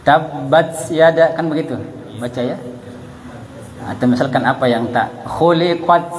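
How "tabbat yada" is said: "Tabbat yada" slow, t released with a hiss; slowly sibilate the t in "Tabbat yada"; slow demo of the wrong hissed "Tabbat". Shown as a demonstration. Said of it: "Biasa dibaca berdecis".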